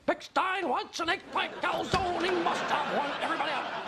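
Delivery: big, high-pitched announcement; bellowed order. Acted. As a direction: call out a lunch order to the whole room. Boss loudly orders staff out for lunch.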